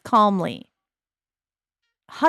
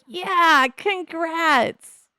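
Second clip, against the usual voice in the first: pitched-up congratulating buddy; voicing a friend; 9 semitones higher, much slower than usual. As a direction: chirp it like a cheery sim friend. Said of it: "They're like".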